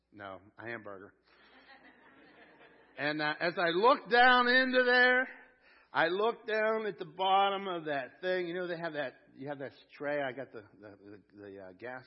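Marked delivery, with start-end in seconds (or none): 3.0-8.5 booming, slow preacher voice; loud. Real, not acted. Preacher booming to the congregation, loud and slow. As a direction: lean in and boom it out slowly.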